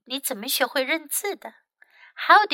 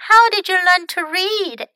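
put-on high, louder child's question; curious little girl; 8 semitones higher, 7 dB louder than usual. Molly asks the grandfather about reading, high girlish question. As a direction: ask it high, as an inquisitive girl. Voices young Molly asking.

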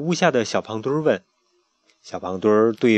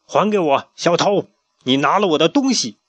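loud, higher accusing demand; angry demand; 6 semitones higher, 4 dB louder than usual. Acted. Pharaoh accusing the thief chick, angry demand. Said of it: "法老指着小胖墩儿说".

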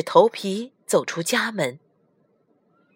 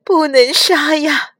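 raised, imploring maid's voice; tearful plea; 6 semitones higher, 5 dB louder than usual. A narrator's reading. Weeping maids beg the master, tearfully pleading. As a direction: plead as if in tears, a little louder. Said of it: "侍女们在哭泣".

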